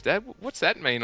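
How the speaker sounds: put-on a curious child asking dad; son asking innocently. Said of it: "he looks at me and he goes".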